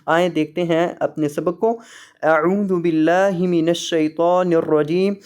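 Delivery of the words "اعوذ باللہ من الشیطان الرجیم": slow, drawn-out tajweed recitation; Quranic recitation. Real, not acted. Opening the lesson with taawwuz and bismillah, slow recitation. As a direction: recite with long held vowels.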